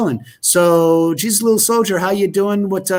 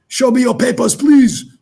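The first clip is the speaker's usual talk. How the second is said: higher, louder put-on checkpoint officer; mock official demand; 8 semitones higher, 6 dB louder than usual. Teasing Natalie about lockdown, mock official's demand.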